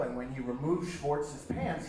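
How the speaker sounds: normal talking voice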